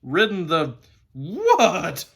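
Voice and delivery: loud, slow whinnying WHAT; horse's neigh. A narrator's reading. Horse retorting to the boy, contempt turning into a neigh. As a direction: neigh the words in outrage, stretched out and high. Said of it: "it came out in sort of a neigh".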